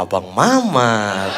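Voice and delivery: very slow, low, stretched name; tersadar kaget. Acted. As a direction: draw the name out as it sinks in. Fan caught out, realising mistake. (pelan dan berat, baru tersadar)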